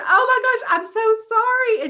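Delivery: high-pitched, gushing retold apology; flustered apology.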